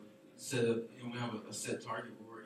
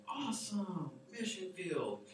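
higher, bright burst of enthusiasm; excited retold reply; 6 semitones higher, 3 dB quieter, slower than usual. Preacher retelling his eager reply to a young man, excited. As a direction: play up the thrill at his answer.

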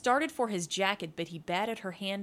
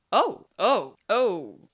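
flustered; 2 semitones higher, 11 dB louder, slower than usual. A narrator's reading. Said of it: "Tootsie flushed".

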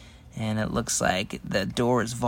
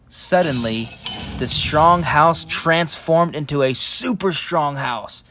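usual speaking voice

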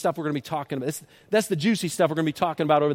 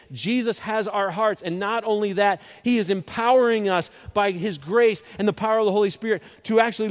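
raised, loud pulpit voice, slowing right down on the last line; full-force preaching; 6 semitones higher, 6 dB louder than usual. Acted. Pastor driving the point home to his congregation, loud and rising. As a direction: preach it hard, climbing to the big finish.

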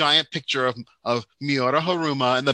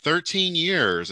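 drawn-out, higher host's remark; imitating the host; 6 semitones higher, slower than usual. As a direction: marvel slowly, quoting the host. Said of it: "And he was like".

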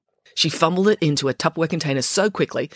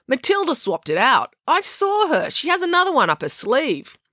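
put-on lightly higher girl's voice, insistent; tattling schoolgirl, a bit raised; April's voice; 11 semitones higher than usual. A narrator's reading. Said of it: "said April".

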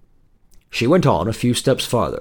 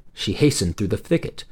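ordinary delivery

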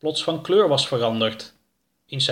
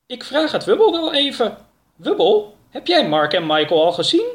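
high character voice, louder on the name; Oroka, enquiring; 9 semitones higher, 3 dB louder than usual. A narrator's reading. Oroka asking Wubble about Mark and Michael, high voice. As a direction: pitch it up, calling over to ask.

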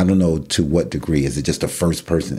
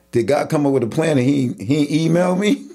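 mock bewilderment; 10 semitones higher than usual. Jokingly voices a puzzled onlooker.

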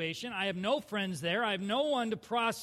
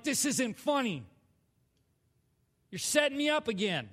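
re-enacted indignant complaint, pitched up; mad; 4 semitones higher than usual. Accusing God of setting him up, mad. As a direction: protest with a touch of anger. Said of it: "I'm mad. I'm still mad".

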